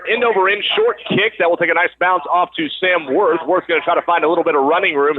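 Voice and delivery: ordinary talk, nothing marked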